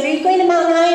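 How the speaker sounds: high, loud, eager imitation of the asker; mock eager friend. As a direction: play up the chirpy invite from the others. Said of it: "They'd be like".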